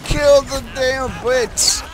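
high, drawn-out rallying call; mock war cry. Player urges troops against the Brits, mock war cry. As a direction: play the commander urging troops on. Playing commander urging troops on.

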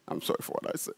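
whispered I'm sorry for what I said; whispered apology. Shown as a demonstration. Preacher acting the apology, whispering. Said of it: "So now I'm calm".

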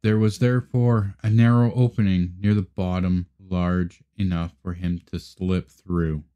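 usual speaking voice

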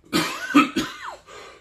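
exaggerated cough for effect; mock cough. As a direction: act out a startled cough. Acts out a startled cough.

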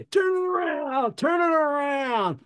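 very high, drawn-out mock holler; imitated frantic yelling. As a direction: holler urgent orders at the driver. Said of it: "he starts yelling again".

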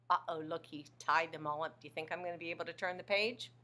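ordinary delivery

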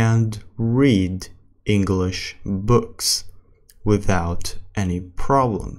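very slow English with long pauses; slow practice reading. Shown as a demonstration. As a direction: read word by word for learners. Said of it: "السرعة الثالثة اللي هي الطبيعي".

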